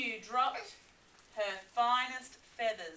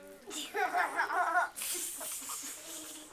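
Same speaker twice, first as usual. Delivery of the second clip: gleeful cackle; 5 semitones higher than usual. Acted. Dingo's laugh.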